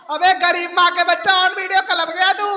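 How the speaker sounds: ordinary talk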